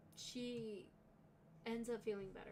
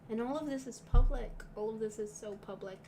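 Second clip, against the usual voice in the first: much louder, angry burst on public; loud outburst; 11 dB louder than usual. Real, not acted. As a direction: blurt it out in frustration. Upset rant about public abuse.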